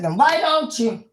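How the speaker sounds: challenging. Retold or imitated. Group answering a struggling person, challenging.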